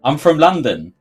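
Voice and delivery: put-on loud, high London accent rant. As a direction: play the proud Londoner, mock offended. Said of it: "This, this is a clear, thick London accent".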